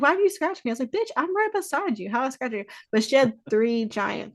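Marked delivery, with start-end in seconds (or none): 0.0-2.6 startled. Retold or imitated. Sisters' startled exchange.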